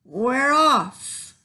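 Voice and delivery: hammed-up crew cheer; crew yell. A narrator's reading. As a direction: shout it like a crew setting sail. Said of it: "the crew yelled".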